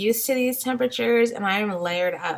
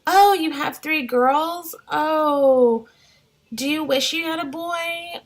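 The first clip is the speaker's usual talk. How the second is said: lightly voiced, higher sugary busybody; nosy nice people; 6 semitones higher than usual. Strangers asking mom of three girls about a boy, lightly mimicked sweet prying. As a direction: chirp it up like a well-meaning stranger. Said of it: "and they're like".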